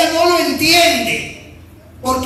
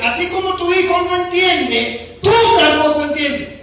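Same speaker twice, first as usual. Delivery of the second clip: preaching at full force; 3 semitones higher than usual. Acted. Preacher voicing God.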